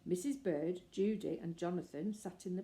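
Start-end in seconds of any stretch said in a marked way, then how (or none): none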